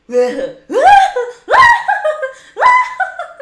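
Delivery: very high, loud cackling laugh; hammed-up goofball cackle, far above your own voice. Shown as a demonstration. Streamer performing the requested laugh for viewers, Ed laugh. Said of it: "There's the Ed laugh".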